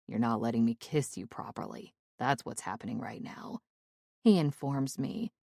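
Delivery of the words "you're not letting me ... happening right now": a hint of a lower teasing man; lower male lover. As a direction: drop lower and tease her softly.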